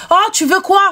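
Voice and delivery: high, exasperated comeback, acted out; mock irritated retort. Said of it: "tu t'es énervé".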